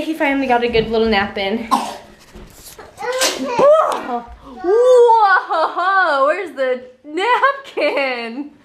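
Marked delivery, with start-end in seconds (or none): 3.0-8.5 high laughing whoa; startled then cracking up; surprised laugh. Real, not acted. Mom reacting to baby's mess, laughing surprised. Baby messy moment.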